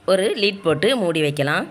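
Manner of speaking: normal talking voice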